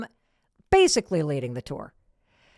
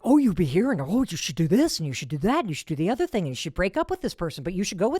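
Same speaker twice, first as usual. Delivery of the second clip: nagging parent; faster than usual. Retold or imitated. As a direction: pile on the advice breathlessly. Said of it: "somebody annoying like me going".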